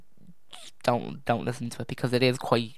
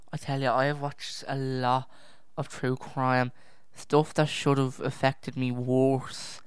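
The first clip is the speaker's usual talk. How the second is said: ordinary talk, nothing marked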